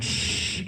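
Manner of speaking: sharp hushing shh; urgent shush to silence a child. Retold or imitated. Hushing his daughter.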